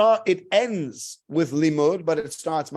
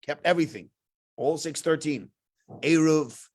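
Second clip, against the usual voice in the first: ordinary talk, nothing marked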